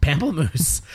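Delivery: Pamplemousse echoed high and loud in disbelief; surprised echo. Real, not acted. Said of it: "You learned that in France?".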